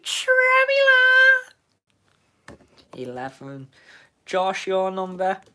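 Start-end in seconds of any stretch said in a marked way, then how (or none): 0.0-1.0 very high, loud squeal; squealed shout. Acted. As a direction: shriek the word out. Squealed name.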